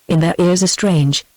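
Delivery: ordinary delivery, nothing marked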